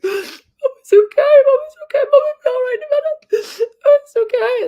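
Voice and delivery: very high, loud, tearful laugh-sob; crying reassurance. Retold or imitated. Sobbing mum reassuring her worried kids. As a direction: force a brave "okay" through tears.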